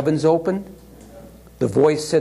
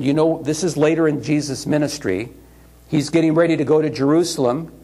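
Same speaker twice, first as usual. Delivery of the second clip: usual speaking voice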